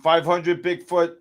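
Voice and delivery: ordinary delivery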